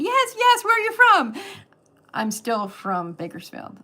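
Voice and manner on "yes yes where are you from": high, loud mock excitement; excited American fans. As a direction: imitate eager fans, pitch leaping way up. Acting out Americans' excited reaction.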